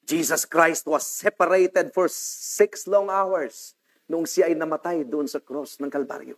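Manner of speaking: ordinary talk, nothing marked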